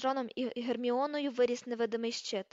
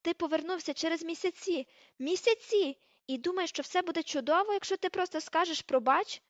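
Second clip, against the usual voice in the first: higher, louder indignation; incredulous angry tirade; outraged rebuke; 5 semitones higher, 3 dB louder than usual. Acted. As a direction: berate him in disbelief. Hermione berating Ron for returning late.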